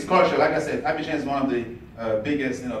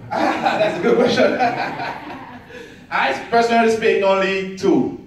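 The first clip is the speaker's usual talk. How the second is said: chuckling through the answer, higher and louder; laughing; 9 semitones higher, 4 dB louder, slower than usual. Real, not acted. Student asks about languages, answering with a real laugh. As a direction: laugh it off as you answer the audience question.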